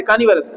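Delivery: कार्निवोरस said kaanivaras, r's silent, varas not vorus; कार्निवोरस with both r's silent and varas at the end; correct pronunciation. Shown as a demonstration. Teacher सही उच्चारण: कार्निवोरस, दोनों आर silent. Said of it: "दोनों आर silent है".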